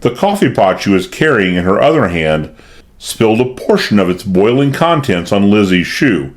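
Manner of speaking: plain reading voice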